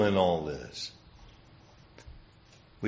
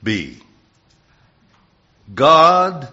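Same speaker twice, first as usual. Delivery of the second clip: usual speaking voice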